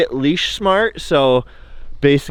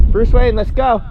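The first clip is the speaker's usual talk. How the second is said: calling out to dog; 9 semitones higher, 5 dB louder than usual. Real, not acted.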